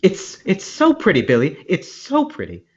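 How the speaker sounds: lightly voiced high movie quote; high, teasing quote with "so" lifted; film line impression. Comic quoting a film character to Billy, high-pitched impression.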